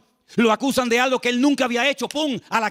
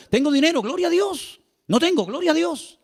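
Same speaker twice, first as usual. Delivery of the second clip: higher cheerful praise; 8 semitones higher than usual. Real, not acted.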